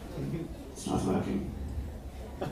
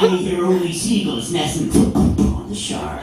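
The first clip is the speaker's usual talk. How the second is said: higher, hammed-up Irish villain with creaking-door "shore"; Irish villain exaggerated; 7 semitones higher, 4 dB louder than usual. Irish villain mocked for creaky door sound, hammed-up high voice. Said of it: "It sounds like a fucking door opening".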